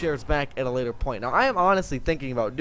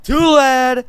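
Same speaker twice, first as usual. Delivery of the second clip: high, loud taunting sing-song; mocking harsh guard; 9 semitones higher, 9 dB louder than usual. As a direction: sneer it down at the prisoner. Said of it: "We'd be like".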